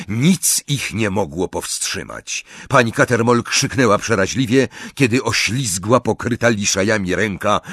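ordinary delivery

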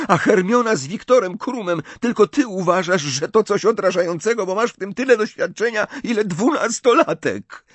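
high-pitched angry tirade, near tears; upset outburst. A narrator's reading. Ginny berates Ron, upset and high-pitched. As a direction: pour it all out at your brother. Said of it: "teraz już bliska łez".

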